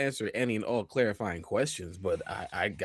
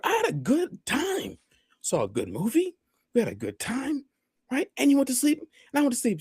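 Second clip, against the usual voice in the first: high chipper oblivious. Retold or imitated. Cheerful oblivious retelling.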